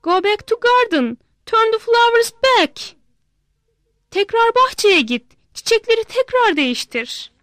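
a daughter begging her father to undo it; pleading little girl. Acted. Girl demands flowers back.

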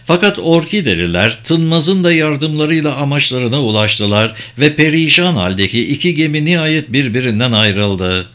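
usual speaking voice

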